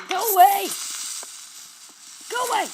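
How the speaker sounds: high, very loud shriek; scream. Real, not acted. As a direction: scream in terror. Screaming at monster.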